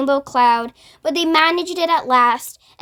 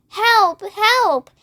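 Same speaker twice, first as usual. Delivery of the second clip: high, mildly raised cries of help; mock calling for help; 6 semitones higher than usual. Miss Spider imitating her stuck grandmother's mild calls for help. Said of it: "hear her calling to us".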